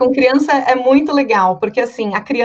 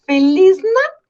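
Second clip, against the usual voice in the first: put-on much higher and louder, a toddler's cheer; bright child greeting, pitched way up; a small girl's Christmas greeting, much higher and louder; little girl's voice; 8 semitones higher, 4 dB louder, slower than usual. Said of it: "que ela falava assim".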